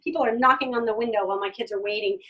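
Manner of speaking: normal talking voice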